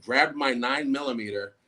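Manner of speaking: ordinary talk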